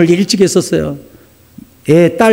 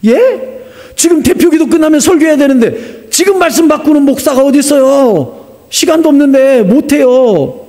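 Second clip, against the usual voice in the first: acted-out exasperated complaint; flustered protest; 8 semitones higher than usual. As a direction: argue back in mock dismay, pitch jumping up. Pastor protests the Spirit's sudden order.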